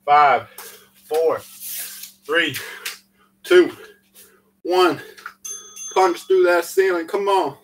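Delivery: loud, high coach shouting the countdown. Real, not acted. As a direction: yell the class through the last reps. Coach pushing class through final reps.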